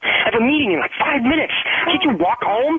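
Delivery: high-pitched, exasperated refusal; angry refusal. Real, not acted. Boyfriend with a meeting refusing a pickup, angry.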